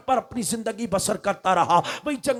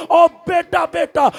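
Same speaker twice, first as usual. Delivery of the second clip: desperate cry, much higher and louder; mother's scream; 6 semitones higher, 6 dB louder than usual. Acted. Mother screams for her son burning in the fire, desperately. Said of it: "کہہ کر ماں پکارنے لگی ہے".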